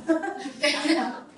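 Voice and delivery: a short, soft laugh high up; high breathy laugh. Real, not acted. Storyteller laughing at how it came true, soft high laugh.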